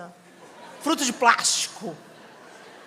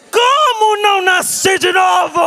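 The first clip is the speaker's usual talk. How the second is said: higher, much louder, slow outraged shout; grito indignado; 6 semitones higher, 8 dB louder, slower than usual. Retold or imitated. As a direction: bellow it in disbelief. Revolta com a fala da mãe. (filha berrando indignada, bem devagar)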